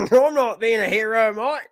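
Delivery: high, loud mock Aussie protest; broad Australian accent, rising on the last word; hammed-up Australian retort; Australian interviewee impression. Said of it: "this guy right here being interviewed".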